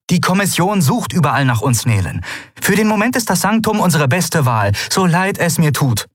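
high-pitched, earnest refusal; Endriel, firm and regretful. Acted. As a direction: overrule a friend firmly but with regret.

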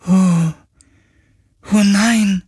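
grief breaking in; dismayed spirit. A narrator's reading. Ghost learns its creators are dead, high dismayed cry.

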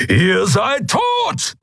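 a slow, booming roar of rage; a caged giant roaring threats at the captors; thundering Keru. A narrator's reading. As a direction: bellow it out slowly and deeply. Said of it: "donnerte Keru".

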